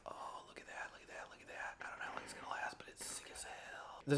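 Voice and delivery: hushed whisper over the model; whispering in awe at a miniature taking shape. Real, not acted. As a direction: breathe it out so as not to disturb the glue. Quiet awe over painted miniature.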